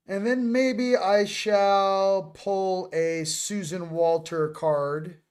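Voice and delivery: very slow, teasing delivery; half-speed, lingering on each word; drawn-out announcement. Real, not acted. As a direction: stretch every word out deliberately. Playfully drawn-out announcement of next card.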